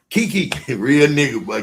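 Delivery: ordinary delivery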